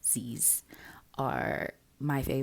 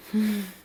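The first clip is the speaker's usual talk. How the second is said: short laugh; 6 semitones higher, 4 dB louder than usual. Real, not acted. Laugh after thanking viewers.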